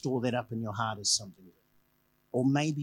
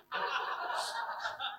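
breathy laugh; 7 dB quieter than usual. Real, not acted. Laughs about paying customers.

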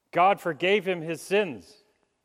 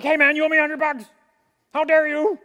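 high, loud acted fury; outraged shout; 11 semitones higher, 11 dB louder than usual. Forgiven debtor demanding his money from a man who owes him, outraged shout. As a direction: yell it like you've been cheated.

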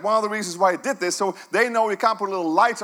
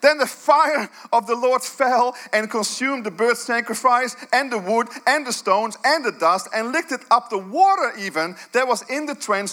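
dramatic reading; 4 semitones higher than usual. Acted. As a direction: proclaim the fire falling, building awe. Climactic scripture reading.

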